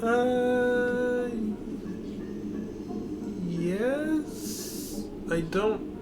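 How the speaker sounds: high, drawn-out uncertain squeak; hesitant, unsure. Real, not acted. Co-player asked about using Uku again, hesitant high-pitched reply. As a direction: answer in a high, questioning voice, stalling.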